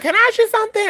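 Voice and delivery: high-pitched imitation of a curious man; tentative stranger. A cousin approaching in the store, high-voiced question.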